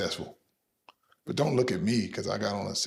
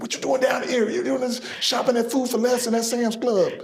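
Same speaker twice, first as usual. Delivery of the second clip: mock surprised fan; 12 semitones higher, 5 dB louder than usual. People surprised seeing him shopping.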